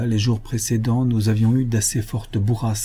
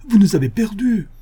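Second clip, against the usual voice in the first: much higher, louder reproach; alarmed outcry; 11 semitones higher, 5 dB louder than usual. Acted. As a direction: shout it out in dismay. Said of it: "M'écriai-je". (crier, affolé)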